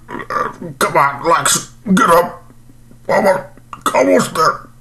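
high, slow strained push to get up; straining to stand. Acted. Stuffed man urging himself off the floor, strained and high. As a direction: grunt it out like lifting a heavy body.

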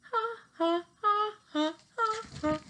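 high giggling ha ha; high laughter. Acted. Laughing again.

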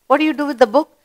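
ordinary talk

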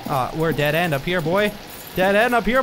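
ordinary delivery

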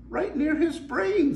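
high, slow mermaid voice; matter-of-fact sea maiden. Acted. Mermaid explaining to the pirate, high and slow.

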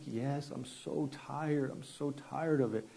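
soft, slow, weary mock tearfulness; imitated crying. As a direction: gently act out a tearful admission. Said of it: "she just starts crying".

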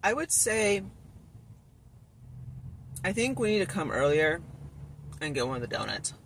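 speaking between bites of pastry; talking while chewing. Real, not acted. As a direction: mouth partly full of donut. Seen 0: Bites the pastry at 9-16s and talks between bites.

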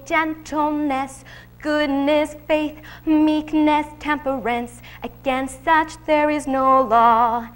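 slow, lingering list of virtues; preacher recites the fruit of the Spirit; scripture reading. Acted. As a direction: savour each fruit of the Spirit slowly. Reciting Galatians over music.

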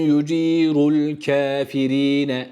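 drawn out, each sound held; slow recitation. Shown as a demonstration. Tutor models the verse slowly. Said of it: "Kafirine değil".

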